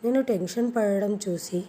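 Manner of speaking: ordinary delivery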